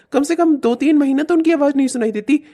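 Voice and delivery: usual speaking voice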